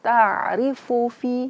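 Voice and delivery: slow recitation of "Ta'rifu fi" with a medium ta; slow Quranic recitation. Shown as a demonstration. Teacher demonstrating the ta for students, slow recitation. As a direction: recite "Ta'rifu fi" slowly, the ta neither stopped nor flowing. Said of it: "Antara keduanya, tengah-tengahnya".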